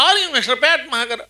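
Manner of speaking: high, nasal Are you మిస్టర్ ప్యాట్మాకర్ with a flat American a; mock the airport clerk's nasal American accent; nasal American twang. Airport clerk in Los Angeles calling his name, mocking nasal American accent. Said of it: "ముక్కుతోటి".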